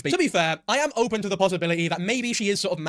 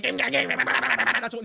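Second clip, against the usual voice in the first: New York babble; 6 semitones higher than usual. Retold or imitated. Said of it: "all New Yorkers sound like".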